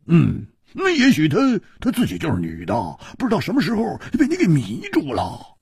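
高而亮的粗豪汉子嗓音，感慨不已; 又佩服又唏嘘地嘟囔; 胡铁花的声音. Acted. 胡铁花说. (高亢的胡铁花感叹)

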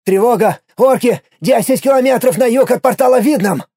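loud, high urgent alarm; alarm shout. Acted. Alarm message sent.

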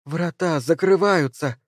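put-on high, slow childlike cry; lightly voiced child, drawn out; child's voice. A narrator's reading. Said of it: "произнёс детский голос Эйдери".

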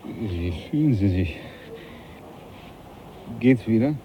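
captain softly asking the hurt Darwin how he is; tender concern. Acted. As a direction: ask gently at a sickbed. Captain checks on the beaten Darwin.

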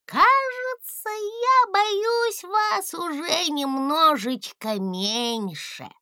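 very high, slow and shy; a small dragon hesitating, a bit bashful; hesitant little dragon. A narrator's reading. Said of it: "Гусля замялся".

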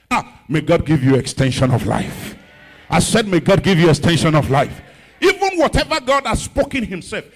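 very loud shouted blessing; full-force preaching. Real, not acted. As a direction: thunder the blessing over the crowd.

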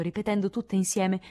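ordinary delivery, nothing marked

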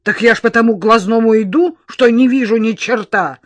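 loud, indignant bark; barked retort. A narrator's reading. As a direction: bark back at an obstructive clerk. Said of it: "рявкнула тётка".

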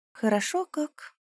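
high, slow, soft envious sigh; quiet envy behind the words; hint of envy. A narrator's reading. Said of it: "со странным чувством лёгкой зависти".

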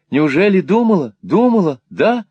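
high, eager repeated questioning; overjoyed disbelief. A narrator's reading. Smike asks Newman if she thought of him, overjoyed disbelief. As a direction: ask again and again, voice leaping up.

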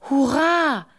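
high, loud cheer of joy; a bright hurrah from the fools. Acted. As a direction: shout it out happily. Dunces cheer.

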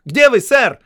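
громкий высокий окрик в лес; позвать громко, на весь лес; крик. A narrator's reading. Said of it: "крикнул". (громко кричит в лесу)

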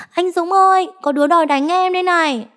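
high, loud call for help. A narrator's reading. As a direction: yell for the bouncer. Girlfriend calls bouncer for help. (cô bồ gọi to anh Dũng ra giúp)

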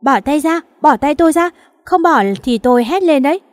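high, loud panicked protest; a girl grabbed by thugs, panicked and yelling at them. Acted. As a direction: push him off, terrified and shrill. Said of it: "Cô gái hoảng hốt".

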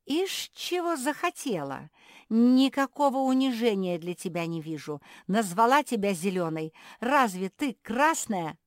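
loud, high, scornful retort; flaring indignation. A narrator's reading. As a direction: fire back with outraged mockery. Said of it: "У Куки засверкали глаза".

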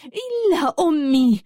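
high, drawn-out angry cry; roaring anger. A narrator's reading. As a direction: cry it out slowly, protecting mother. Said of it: "وصاحت بغضب هادر". (صيحة بغضب هادر)

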